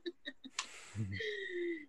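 soft high laugh; light, airy laughter. Real, not acted. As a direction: let out a quiet, drawn-out chuckle. Amused at the story she tells.

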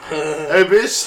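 lightly voiced robot servant; butler robot. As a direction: call out playfully as a robot butler. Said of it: "I'm your butler robot now".

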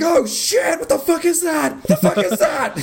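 high-pitched freak-out with a laugh creeping in; mock raptor panic. Raptors hearing the conch, mock panicked shrieking. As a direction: panic like a startled raptor, hammed-up. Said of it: "raptors just start going ape shit".

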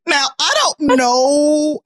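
slow stretched out confusion, high; slow baffled. Real, not acted. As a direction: drag it out, bewildered. Reacting to wrong identity.

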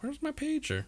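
high-pitched quoted question; quoted worried question. Retold or imitated. Retelling the night the pager went missing, high-pitched question. Quoting own bedtime alarm.

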